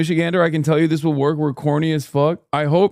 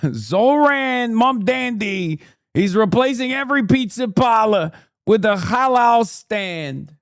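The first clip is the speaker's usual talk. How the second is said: mock outraged name-mangling; 6 semitones higher than usual. Mocking name, then mock outrage.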